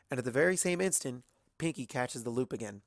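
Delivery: usual speaking voice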